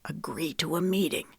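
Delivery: whispered urgent prompt. A narrator's reading. Knox prompting Lemon so Wesley cannot hear, whispering. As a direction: breathe the instruction silently. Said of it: "Knox whispered".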